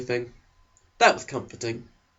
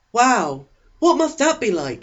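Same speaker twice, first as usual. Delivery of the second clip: put-on higher, louder eager teen boy voice; eager Eric; 9 semitones higher, 4 dB louder, slower than usual. Eric leaning over to the new girl, eager and high. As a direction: pitch him up high and keen, a chess-club type. Eric wonders about Phoenix.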